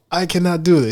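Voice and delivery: put-on African accent. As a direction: refuse in a mock African accent. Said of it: "little accents like that".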